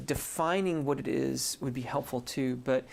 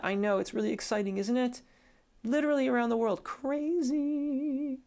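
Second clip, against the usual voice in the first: excited; 11 semitones higher than usual. Real, not acted. Reacting to worldwide viewers.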